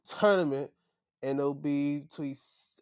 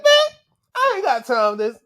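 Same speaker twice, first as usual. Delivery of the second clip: dismissive; 8 semitones higher, 11 dB louder than usual. Retold or imitated. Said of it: "he's like".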